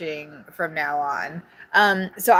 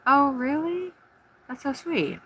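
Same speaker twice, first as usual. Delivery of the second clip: high sweet voice; 5 dB quieter than usual. Real, not acted. Sweet high voice on Mother's Day.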